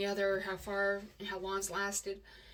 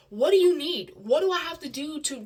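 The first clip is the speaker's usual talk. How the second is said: loud, higher imploring questions; exasperated plea; 6 semitones higher, 10 dB louder than usual. Retold or imitated. Imitating partner begging her to stay, exasperated. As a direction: plead with raised voice, at a loss.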